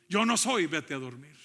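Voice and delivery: acted impatience, punching the first words; insistent dismissal. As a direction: send the child off emphatically. Elí dismisses Samuel again, more insistently.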